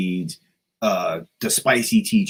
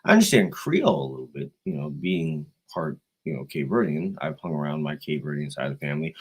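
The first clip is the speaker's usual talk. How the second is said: normal talking voice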